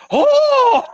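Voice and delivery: high, loud, surprised "Oh!"; group gasp of delight. Retold or imitated. Friends in Japan hear him say y'all, surprised. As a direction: gasp as if he just proved it. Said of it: "This entire group all at once goes".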